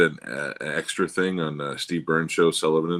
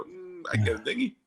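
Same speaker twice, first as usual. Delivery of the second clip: high, soft put-on voice; mock-coy reply, rising at the end; coy answer; 9 semitones higher, 7 dB quieter than usual. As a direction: borrow the caller's high lilt, softly. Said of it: "It's the best way when somebody asks".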